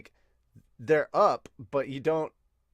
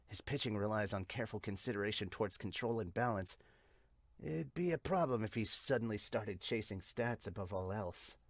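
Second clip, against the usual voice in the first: soft, low character voice; measured observer; 4 semitones lower, 10 dB quieter than usual. Acted. Observer weighing the pitcher's control, soft measured voice. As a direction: keep it quiet and considered.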